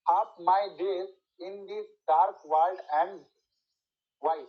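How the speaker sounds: very slow, stresses marked; stressed verse reading. Shown as a demonstration. Teacher reads the next Milton line slowly, marking stress. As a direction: read it slowly, landing each stress. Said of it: "and টা হচ্ছে unstress".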